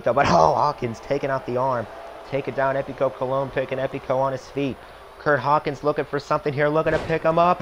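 wrestling commentator. Acted. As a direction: hype up the finish like a TV announcer. Back to calling the match.

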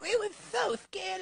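ordinary talk

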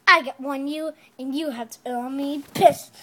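ordinary talk

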